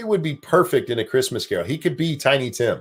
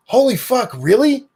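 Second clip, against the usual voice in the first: high, loud shocked outburst; a sudden burst of real disbelief; 13 semitones higher, 3 dB louder than usual. Real, not acted. Shocked at Bear's son's age.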